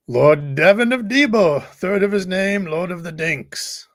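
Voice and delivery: slow, grand herald proclaiming a lord's title; mock royal herald. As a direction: announce him like a pompous court crier, slowly.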